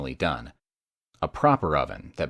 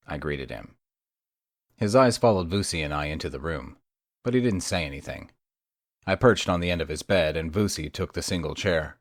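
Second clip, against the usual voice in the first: usual speaking voice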